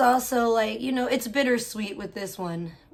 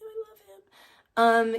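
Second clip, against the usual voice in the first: drawn out slowly and much higher; gushing love; 10 semitones higher, 4 dB louder, much slower than usual. Real, not acted. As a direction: sing it out, melting with love. Gushing about sweet Jose.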